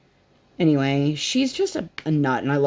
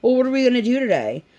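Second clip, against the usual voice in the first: higher, quick mimicked kid question; imitated pestering daughter; 7 semitones higher, 3 dB louder, faster than usual. Mom imitating daughter asking for the day's plans, pestering. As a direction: lightly mimic an eager child asking. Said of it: "now our daughter, seven thousand times a day".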